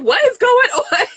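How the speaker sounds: high, slow, laughing disbelief; bewildered exclamation. Retold or imitated. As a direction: squeal it out in amused shock. Reacting to a chaotic scene.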